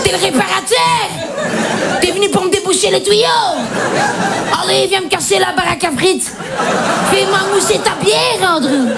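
high, hammed-up Belgian accent; high-pitched, exaggerated Belgian-accented come-on; Belgian porn parody. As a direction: play a Belgian porn actress with a broad accent. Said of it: "T'as déjà vu un porno belge?".

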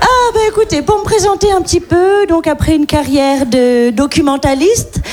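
a light put-on accent of a rich French lady; posh rich-lady accent. A bourgeoise introduces herself to the audience in a rich-lady accent. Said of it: "parfaite maîtrise de l'accent de riche".

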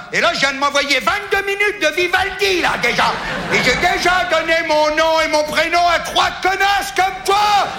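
crier tout, de plus en plus aigu; hurlement primaire. Retold or imitated. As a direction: exploser de rage contre la secrétaire. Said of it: "Le hurlement primaire". (hurlement de rage au téléphone)

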